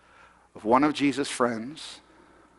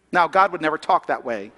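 ordinary talk, nothing marked

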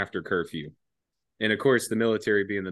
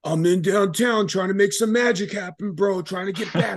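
put-on much higher, louder drunken excitement; drunk hype; 12 semitones higher, 5 dB louder than usual. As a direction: hype it up like a drunk kid on a mission. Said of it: "And I'm like".

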